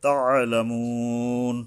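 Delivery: তাআলামুন with "moo" held three beats before stopping; three-alif stretch. Shown as a demonstration. Teacher shows the pause rule, তাআলামুন long and slow. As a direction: draw out the last vowel of তাআলামুন. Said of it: "এই ওয়ারের উপরে তিন আলিফ".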